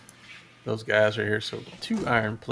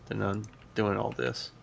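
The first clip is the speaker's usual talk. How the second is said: normal talking voice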